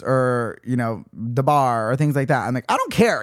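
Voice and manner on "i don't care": high exasperated outburst. Retold or imitated. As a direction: blurt it out, fed up. Venting about wedding spending.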